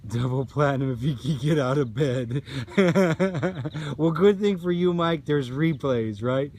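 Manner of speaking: laughing. Real, not acted. Laughing at a viewer's joke.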